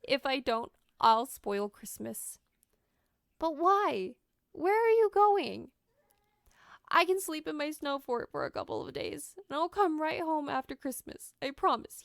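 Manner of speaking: put-on boy explains he will sleep in his snow fort; lightly voiced tearful boy. A narrator's reading. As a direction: plead like a small boy holding back tears. Eric's boy voice.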